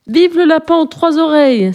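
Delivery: high, loud cheer; cheering cry. Acted. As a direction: lead the crowd in a cheer. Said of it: "s'écria un hanneton".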